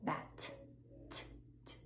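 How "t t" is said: airy T, no voice; unvoiced T. Shown as a demonstration. Teacher isolating the unvoiced T. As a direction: puff T out. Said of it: "no voice".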